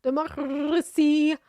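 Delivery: a lightly done posh Australian; imitating his posh rolled Rs. Streamer mimicking the arrested man, lightly posh. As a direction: roll the R in Democracy Manifest. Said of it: "does he speak like this".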